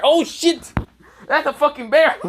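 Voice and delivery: loud, high-pitched shock; mock panic. Student suddenly spotting the bear, loud high panic. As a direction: exclaim in hammed-up fright, very high.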